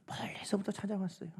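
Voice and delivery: hushed, breathy whisper. Real, not acted. Preacher whispering to the congregation for effect.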